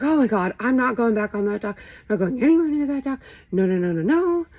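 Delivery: panicked. Retold or imitated. Refusing to return to dock.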